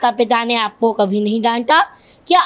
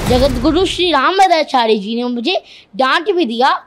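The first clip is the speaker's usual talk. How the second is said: normal talking voice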